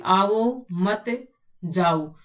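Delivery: very slow, words drawn apart; slow demo. Shown as a demonstration. Teacher drawing out the example for students. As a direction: stretch each word out. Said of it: "अब ये है भाई".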